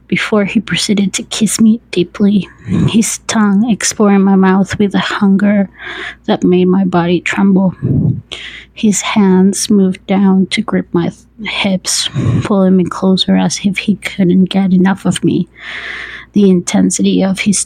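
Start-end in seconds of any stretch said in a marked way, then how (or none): none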